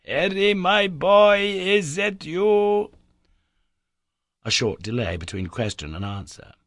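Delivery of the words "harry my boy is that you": loud, slow call across a distance. Acted. Uncle calling to his lost nephew, a loud slow call. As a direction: call out slowly to someone far away. Uncle calls through rock.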